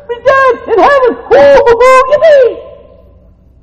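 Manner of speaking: high, loud jubilant cries; ecstatic shouting. Real, not acted. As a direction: whoop for joy. Preacher shouting joy of heaven.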